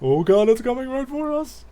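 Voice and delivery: high, loud pretend alarm; mock panic. Player reacting to shape on screen, mock panicked cry. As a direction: cry out in hammed-up terror.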